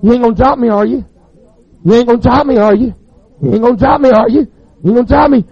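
put-on a scared boy begging not to be dropped; pleading boy. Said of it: "he heard this boy say".

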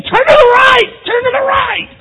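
furious shouted command at a horse; angry yell. Pretend. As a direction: yell it, yanking the reins. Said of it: "you gotta yell and scream".

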